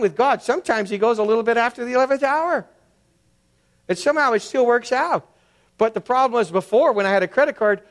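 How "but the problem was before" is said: normal talking voice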